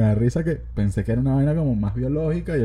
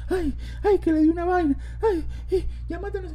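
very high, hammed-up falsetto wail; falsetto wailing woman. Pretend relative wailing over his dying body, hammed-up falsetto. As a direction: screech like a hysterical mourner.

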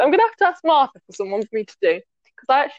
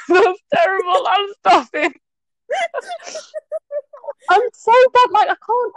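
laughing; 8 semitones higher than usual. Real, not acted. Impression collapses into laughter.

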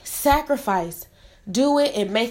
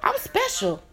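mock inner voice; 13 semitones higher, 3 dB quieter than usual. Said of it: "but you're like".

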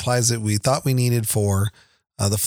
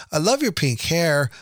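put-on slow, higher-pitched little-boy compliment; Mikey; 6 semitones higher than usual. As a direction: lightly imitate a sweet child. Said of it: "He goes".

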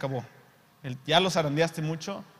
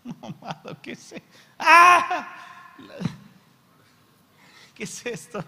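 laughing amazement; 10 semitones higher, 10 dB louder than usual. Acted. Preacher mock-amazed at the promise.

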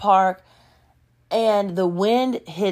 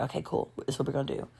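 low, soft plotting voice; hushed agreement; 4 semitones lower, 13 dB quieter, much faster than usual. Retold or imitated. Cousin agreeing to the gum plan, hushed. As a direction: agree quietly, keeping it between you.

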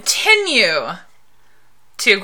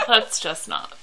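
ordinary talk

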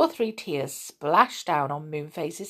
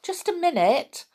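high, raised call to someone below; 11 semitones higher, 4 dB louder than usual. A narrator's reading. Moonface to the goblin on the ladder, calling down. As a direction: call down to catch their attention. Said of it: "he called down to the goblin".

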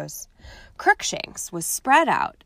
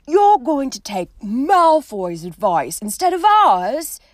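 louder, higher, slow furious accusation; 6 semitones higher, 8 dB louder than usual. Acted. Ron furious at Harry for heeding Malfoy. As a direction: spit the accusation out, outraged. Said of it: "said Ron furiously".

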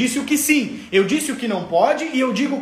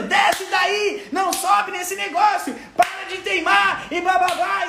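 high, slow, shouted scolding; mock a parent losing it at the kids; imitated yelling; 8 semitones higher, slower than usual. Imitating the yelling father he refuses to be, slow shout. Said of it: "eu não tô gritando".

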